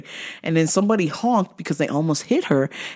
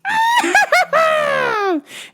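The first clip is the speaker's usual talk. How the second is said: long high shrieking cackle; manic cackle. Retold or imitated. She comes in the store, manic high cackle. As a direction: mimic a crazed, shrieking laugh. Imitates her turning away.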